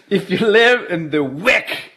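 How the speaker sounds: "live" drawn out slowly with a rising end; slow teasing repeat. Pretend. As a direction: stretch it out, teasing the Wick crowd. Repeats the line after laughing.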